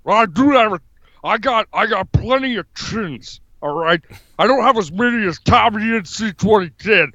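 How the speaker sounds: chinless-sounding tough-guy impression; hammed-up off-grid politician; Jesse Ventura. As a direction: bluster like a wrestler with no chin. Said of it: "it sounds like you don't have a chin".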